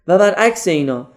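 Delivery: ordinary delivery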